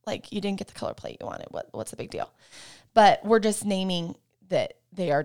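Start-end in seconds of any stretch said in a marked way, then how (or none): 0.1-2.3 whispered, scoffing inner voice; dismissive inner whisper. Retold or imitated. Parent inwardly scoffing at a toddler's plate tantrum, whispered. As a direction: mutter it under your breath, unimpressed. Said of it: "we're like".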